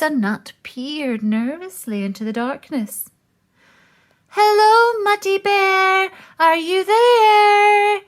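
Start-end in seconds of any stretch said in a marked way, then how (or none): 4.3-8.0 very high, loud shout into the cave. Acted. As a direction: call loudly into the darkness, slowing at the end. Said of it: "Moose shouted".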